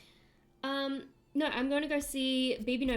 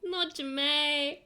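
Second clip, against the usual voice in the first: slow, drawn-out, loud character voice; Jamae; 4 semitones higher, 5 dB louder, much slower than usual. Acted. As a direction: stretch it out in mock dismay, higher and louder. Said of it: "It doesn't help that I love J".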